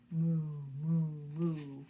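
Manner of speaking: loud mooing cow; low it out like a cow. Acted. Teacher mooing as the cow in the story. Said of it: "says the cow".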